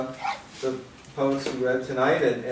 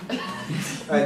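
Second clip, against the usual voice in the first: ordinary talk, nothing marked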